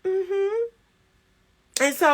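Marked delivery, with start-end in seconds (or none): 0.0-0.6 soft, high hum of listening; acted unmoved listener; noncommittal mm-hmm. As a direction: hum along quietly, giving nothing away. Acting her noncommittal listening to him.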